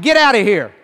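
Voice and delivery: firm loud rebuke; stern command. Shown as a demonstration. Preacher repeats his rebuke to the snake, loud and stern. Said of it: "I said".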